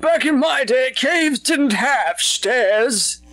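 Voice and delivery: put-on high, slow crotchety old man. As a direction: shout it like a grumpy grandpa. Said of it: "I just like doing old people voices".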